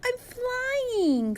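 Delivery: very high delighted squeal. Acted. Penguin sliding down the ice, very high squeal of joy. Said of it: "squealed Flip Flop".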